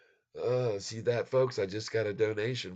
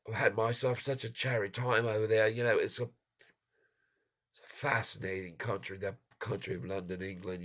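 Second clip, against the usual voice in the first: put-on British accent; 3 semitones higher than usual. Streamer jokingly imitates English folks in a British accent. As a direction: put on a playful British accent, slowing at the end. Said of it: "talk a little bit like the folks from England".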